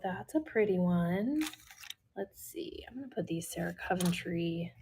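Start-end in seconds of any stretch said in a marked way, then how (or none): 2.2-4.7 fast whisper to herself. Real, not acted. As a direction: whisper it quickly, half to yourself. Muttering to herself while organizing.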